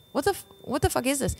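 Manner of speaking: high, baffled protest; retold disbelief. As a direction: act out being baffled by a heckler. Stunned reply to retold heckler.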